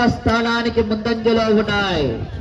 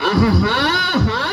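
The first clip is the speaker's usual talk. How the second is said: slow, swooping "aha-haa" cry; rolling announcer's cheer; drawn-out cheer; 2 semitones lower, 4 dB louder, much slower than usual. Real, not acted. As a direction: stretch out a crowd-rousing whoop. Announcer hyping the race crowd.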